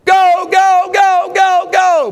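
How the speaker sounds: high, loud chanted cheer; hollering. Acted. Proud grandparents cheering the winning run, shouting. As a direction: scream them on to the winning score. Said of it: "hollering, shouting".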